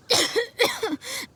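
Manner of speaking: strained cough from a weakened body; harsh sick cough; pained cough. Acted. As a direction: cough as the effort catches you. Weakened knight strains herself.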